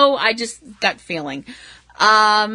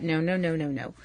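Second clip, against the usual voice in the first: no's trailing off much softer and lower; a softer and softer string of no's; fading to a murmur; 5 semitones lower, 12 dB quieter than usual. Real, not acted. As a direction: talk yourself down into a near murmur. Stopping herself mid-joke.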